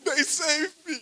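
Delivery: choking up while thanking the family; crying. Real, not acted. Grieving friend crying through thanks to the family at the funeral. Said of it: "stop crying".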